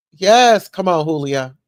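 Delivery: loud cheer, rooting her on; excited cheer. Real, not acted. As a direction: cheer Julia on like at a game. Cheering for the housekeeper's lawsuit.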